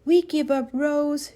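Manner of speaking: loud, high call across the yard; shouting. A narrator's reading. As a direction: call out to a hidden friend. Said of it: "shouted Jed".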